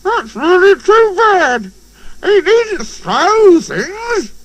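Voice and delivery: high, slow indignant engine voice; high offended little engine; indignant Percy. A narrator's reading. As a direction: complain huffily and slowly. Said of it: "indignant front".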